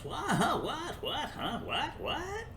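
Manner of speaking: high, rising dim-witted what-huh; mocking confused viewers. Mocking slow viewers puzzled at his story, high baffled squeaks. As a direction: play a baffled dullard squeaking questions. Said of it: "are saying to yourselves".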